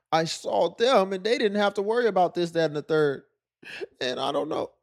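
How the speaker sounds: high, lightly mocking whine; imitating a whiny woman. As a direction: parody her envious complaint. Mimics woman complaining.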